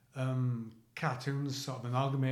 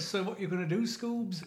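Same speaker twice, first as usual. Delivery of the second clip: cartoon voice rising high at the end; Shaggy from Scooby-Doo; 4 semitones higher than usual. Unable to stop doing the impression, cartoon voice. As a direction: slip back into the squeaky catchphrase. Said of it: "All I can say, though, is".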